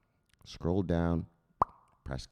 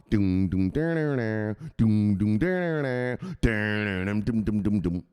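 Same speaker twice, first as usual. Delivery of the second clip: hummed riff; 4 semitones lower than usual. Retold or imitated. Imitating music on the phone.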